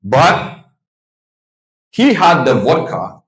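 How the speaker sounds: usual speaking voice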